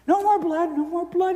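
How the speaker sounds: high pleading cry, mock scream; imitating a terrified sick kid. Sick boy begs the nurses with needles, high pleading scream. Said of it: "he'd scream".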